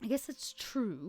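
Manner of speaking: soft, slow resigned voice; quiet concession. Acted. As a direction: concede it softly.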